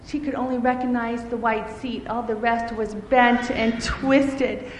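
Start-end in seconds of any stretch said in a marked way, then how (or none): none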